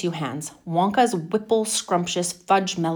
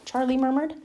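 plain reading voice

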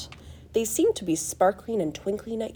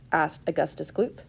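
ordinary delivery

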